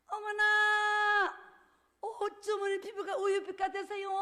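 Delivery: high, slow, drawn-out "어머나" of delight; gushing flattery. Acted. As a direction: gush over her skin like an amazed admirer. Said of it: "이래요". (높고 길게 끄는 감탄조 아첨)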